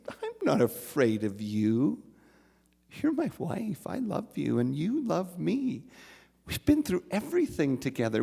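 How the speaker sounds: high, gentle, laughing reassurance; husband soothing his wife in the storm, tender and amused; amused tenderness. Acted. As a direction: reassure her that he loves her.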